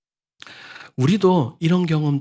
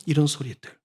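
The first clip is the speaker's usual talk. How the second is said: ordinary talk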